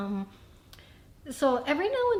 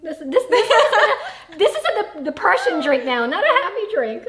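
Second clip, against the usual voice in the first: laughing while joking about a sad drink; giggly, louder delivery; 8 semitones higher, 9 dB louder than usual. Real, not acted.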